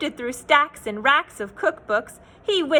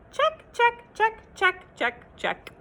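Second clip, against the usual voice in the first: checks fading out; 8 semitones higher than usual. Acted. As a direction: tick them off, then let them trail away.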